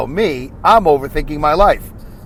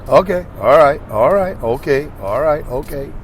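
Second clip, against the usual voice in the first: lightly voiced Denzel, calm and measured; a hint of Denzel easing himself down; Denzel Washington. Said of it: "Kinda do that Denzel Washington thing".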